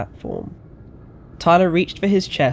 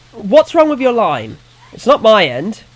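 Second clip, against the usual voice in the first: louder and higher, demanding over the static; annoyed calling out; 9 semitones higher, 9 dB louder than usual. A narrator's reading. To a caller lost in static, annoyed raised voice. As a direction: Tyler straining to hear over the line, irritated. Frustrated at a bad phone line.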